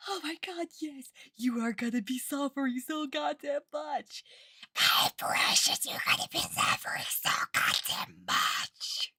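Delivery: shouted then whispered witchy menace; cackle-sweet threats to a baby; evil witch voice. Retold or imitated. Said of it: "she was also like".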